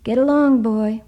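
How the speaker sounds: loud, high call from afar. A narrator's reading. Grandfather calls to Doug from the shore, firm. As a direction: send him off with a firm call.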